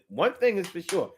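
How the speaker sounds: ordinary delivery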